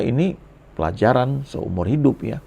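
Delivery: ordinary talk, nothing marked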